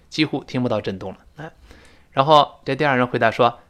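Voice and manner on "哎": usual speaking voice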